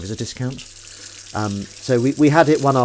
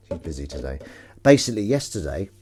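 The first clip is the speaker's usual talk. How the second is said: ordinary talk, nothing marked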